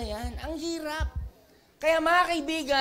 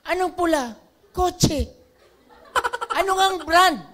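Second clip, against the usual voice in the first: impatient asking; 5 semitones higher, 7 dB louder than usual. Retold or imitated.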